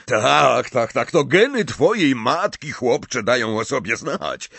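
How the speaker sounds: a delighted teacher fussing over a clever student; jovial old professor. A narrator's reading. Slughorn voiced by narrator.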